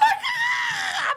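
high startled shriek; embarrassed shriek. Retold or imitated. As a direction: shriek in mock alarm. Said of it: "I'm like".

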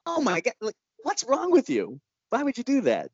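high, incredulous oh my God; mock exasperation. Filmmaker to a man who faked a lawsuit, high and exasperated. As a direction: voice up high, amazed at him. Retold outrage at fake lawsuit.

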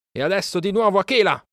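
high-pitched rallying call; shouted command. A narrator's reading. Mowgli to Akela at the herd, shouting. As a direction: call out high to set off a charge. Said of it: "gridò".

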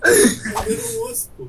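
mock crying.

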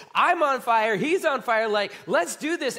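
loud, pumped-up disciple; raring to go; fired up. Acted. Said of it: "the excitement they were all feeling".

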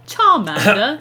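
ordinary talk

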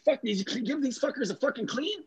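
high-pitched mock angry Finnish-accented snap; imitated angry demand. Player demanding his cleaned clubs, mock angry high voice. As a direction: fire it off like a wound-up hothead. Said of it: "He's like".

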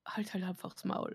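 hushed whispered shut up; whispering. Retold or imitated. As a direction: whisper it under the breath. Annoyed retelling of teammate.

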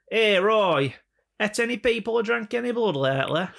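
high-pitched hammed-up heckle; comic put-on voice, pitched right up; joke character. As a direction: call out to an imaginary Roy. Plays someone quizzing vampire actor Roy.

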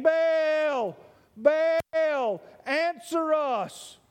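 drawn-out high call to a god. A narrator's reading. Baal worshipers calling to their god, calling out. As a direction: cry it out long, pleading for an answer. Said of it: "noon, saying".

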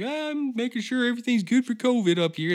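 high, loud mock politician voice; Doug Ford. Doug Ford chatting to people in Muskoka, mock politician voice. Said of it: "Fucking Doug Ford was going around".

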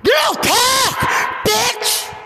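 high, cutting shouted order; furious shout. Real, not acted. Woman screams at someone to shut up, furious shout. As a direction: shout them into silence.

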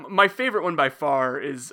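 ordinary delivery

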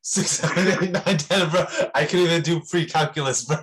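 loud, high, laughing through the count; cracking up at how absurd it is; incredulous laughing rant; laughing disbelief. Real, not acted. Reacting to math levels.

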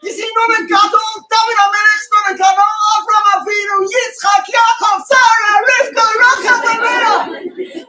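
shouted, high-pitched roll call of names; bursting outburst. Retold or imitated. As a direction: re-enact a bursting, protesting shout. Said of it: "And I'm bursting".

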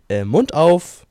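loud, firm mock dentist order; dentist's command. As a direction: bark it like a dentist mid-work. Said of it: "hat er immer gesagt". (lautes Kommando des Zahnarztes)

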